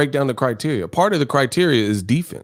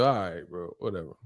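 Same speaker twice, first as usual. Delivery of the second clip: dismissive mutter; 6 semitones lower, 11 dB quieter than usual. Real, not acted. Dismissive aside to chat.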